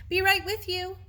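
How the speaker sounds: calling over her shoulder. Acted. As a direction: call out as she runs off. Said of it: "she called over her shoulder".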